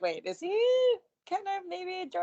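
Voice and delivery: high-pitched incredulous wondering; puzzled surprise. Retold or imitated. Fan recalling his reaction to a surprise entrance, high and puzzled. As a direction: replay a startled, hopeful double take.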